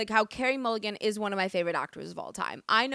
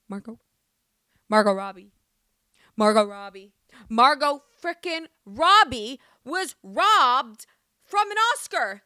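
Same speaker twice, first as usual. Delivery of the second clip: outraged; 8 dB louder than usual. Real, not acted. Outraged that Margot Robbie was robbed, building to an angry shout. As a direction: build to a furious outcry at the snub.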